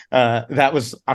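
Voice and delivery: ordinary talk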